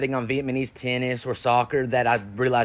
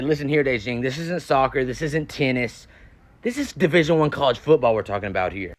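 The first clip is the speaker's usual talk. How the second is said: usual speaking voice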